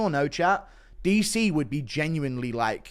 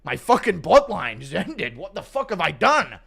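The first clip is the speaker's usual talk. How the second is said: rising panicked shouts, pleading to honey; 5 semitones higher, 4 dB louder than usual. Retold or imitated. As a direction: freak out like a man who just woke from a haze. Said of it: "long-term C T E that he's now snapped out of".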